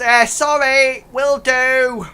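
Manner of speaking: high, loud, drawn-out reply; goblin calling back. Acted. Chastened guard goblin answering the one inside, loud high call. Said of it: "the goblin outside says".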